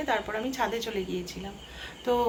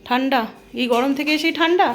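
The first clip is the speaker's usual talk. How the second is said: surprised disbelief; 3 semitones higher, 8 dB louder than usual. Real, not acted. Child asks for cold drink.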